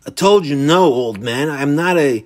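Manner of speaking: loud, heated protest; angry denial. Acted. Michael denying the old man's accusation, angry protest. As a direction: push back hard, cut off mid-sentence.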